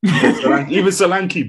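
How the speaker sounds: loud, laughing repeat; excited. Real, not acted. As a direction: hype it up with a laugh.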